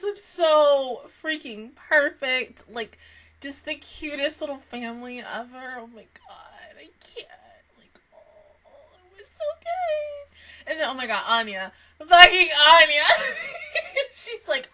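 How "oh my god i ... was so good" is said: awed whisper; unvoiced gasping awe. Real, not acted. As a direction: breathe it out in disbelief. Overwhelmed fan, hushed.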